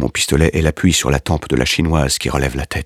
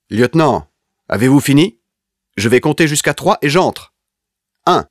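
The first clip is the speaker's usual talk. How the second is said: impatient shout; 10 semitones higher, 3 dB louder than usual. A narrator's reading. Soldier threatens through door.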